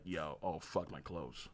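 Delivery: low, soft, offhand mumble; mock casual Saitama. Saitama shrugging off a nuke, low and offhand. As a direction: shrug it off like nothing happened. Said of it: "is like".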